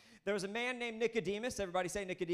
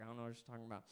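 mumbled confusion; 7 semitones lower, 9 dB quieter, much faster than usual. Retold or imitated. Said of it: "Rest of y'all are like".

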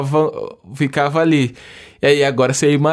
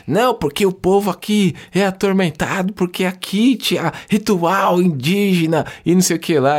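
higher mock preacher tone; church people's voice; 7 semitones higher than usual. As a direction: lightly mimic a church lady. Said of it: "muito se falava na igreja evangélica".

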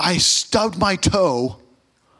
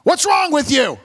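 coach calling; 9 semitones higher than usual. Retold or imitated. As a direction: call the player over, concerned. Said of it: "the coaches called me over".

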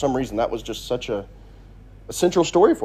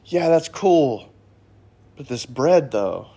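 slow, bored mock shrug; unimpressed shrug; slower than usual. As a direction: brush off the miracle, slowly. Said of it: "they go".

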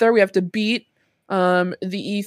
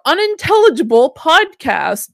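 bright-energy show intro, projected and lifted; podcast opening; 2 semitones higher, 4 dB louder than usual. Real, not acted. Host welcomes listeners to the podcast, announcer-style opening. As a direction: kick off the episode like a host. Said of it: "I'm gonna do my opening".

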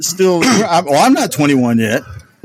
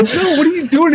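startled high call; 12 semitones higher than usual. Retold or imitated. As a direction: pitch jumps way up in alarmed disbelief. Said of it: "I go".

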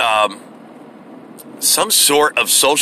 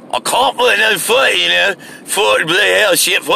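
mock Scottish; 7 semitones higher than usual. Mocking Scottish speakers he cannot understand in a garbled fake Scottish accent. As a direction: babble like a hammed-up Scot. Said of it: "those people from Scotland".

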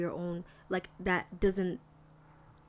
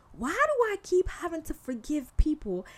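exasperated complaint; 7 semitones higher than usual. Acted. Acting out complaint to God.

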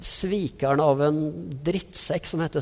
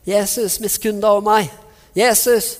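loud desperate cry for mercy; calling out; 6 semitones higher, 7 dB louder than usual. Retold or imitated. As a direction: shout it across the crowd, pleading. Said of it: "han roper". (fortvilet rop om nåde)

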